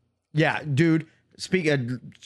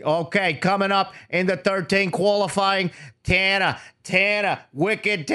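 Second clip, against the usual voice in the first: high-pitched announcer impression with New England slang; Peter Griffith intro; 6 semitones higher than usual. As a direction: imitate a cartoonish race announcer, pitched up. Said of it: "he is going to do full-on Peter Griffith intros".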